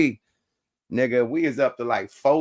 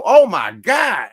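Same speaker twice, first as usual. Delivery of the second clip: slow, louder drawn-out oh my God; exasperated disbelief; 6 semitones higher, 8 dB louder, much slower than usual. Retold or imitated. Hearing the police accusation, slow louder exasperated groan. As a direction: stretch it out in loud disbelief.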